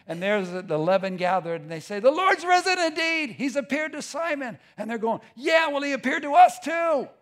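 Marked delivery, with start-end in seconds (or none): 2.0-4.6 high, loud burst of news; excited announcement. Acted. Gathered disciples announce the news. 5.3-7.1 high, loud acted comeback; excited shout back. Disciples answer back, excited.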